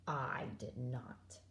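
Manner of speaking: hushed, slow and unvoiced; quiet. Boy reluctant about leaving Korea, whispering. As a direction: breathe it out, sullen and small.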